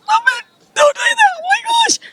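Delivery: very high-pitched, squealed disbelief; re-enacted high squeal of amazement; excited squeal. Acting out her amazed reaction.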